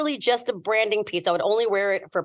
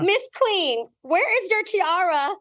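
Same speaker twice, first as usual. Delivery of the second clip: high, slow mock-scolding call; waitress calling her out for no tiara; teasing waitress; 8 semitones higher, much slower than usual.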